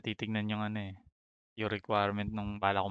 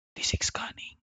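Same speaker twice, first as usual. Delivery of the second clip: awed whisper; 4 dB quieter than usual. Real, not acted. Gasps at a rare drop.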